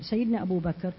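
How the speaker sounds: ordinary talk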